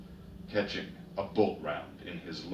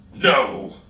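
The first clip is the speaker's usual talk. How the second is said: high, loud sharp no; shouted refusal; 9 semitones higher, 17 dB louder than usual. A narrator's reading. Darkseer forbidding Herrix from going back, loud shout. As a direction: stop him cold with one word. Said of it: "Darkseer shouted".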